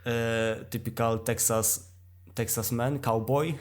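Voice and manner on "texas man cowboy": ordinary talk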